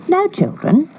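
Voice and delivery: very high, falsely sweet stepmother; wicked stepmother. Acted. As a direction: coax them to lie down. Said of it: "said their wicked stepmother".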